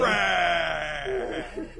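drawn-out roar of the word; imitated creature call; dragon cry. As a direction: bellow it like a happy little dragon.

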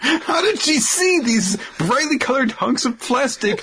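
high-pitched, slow incredulous wail; hammed-up astonishment, stretching the words; mock disbelief. Co-host joins the joke about the caught kids, mock disbelief.

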